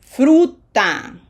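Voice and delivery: Fruta said loud and clear; Spanish pronunciation model. Shown as a demonstration. Teacher modelling a Spanish word loud and clear. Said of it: "we have to pronounce each vowel".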